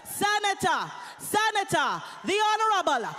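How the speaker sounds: loud, building announcer call; announcer intro. Real, not acted. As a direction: hype up the speaker's name. MC introducing senator to crowd.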